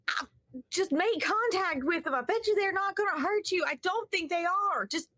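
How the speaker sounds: Southern townsperson reassuring over the radio; country Southern accent. Acted. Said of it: "the most country Southern accent".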